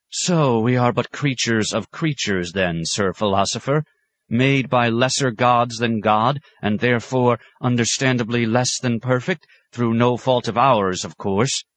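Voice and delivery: mock the scholar's idea with understated scorn; lightly voiced sarcastic abbot. A narrator's reading. Abbot confronting his guest, understated sarcasm.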